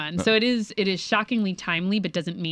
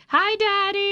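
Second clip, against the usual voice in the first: put-on high, drawn-out little-kid holler; imitated child's scream; much slower than usual. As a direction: mimic a small child calling out, pitched way up. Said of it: "she screamed".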